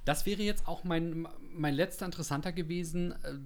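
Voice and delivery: ordinary delivery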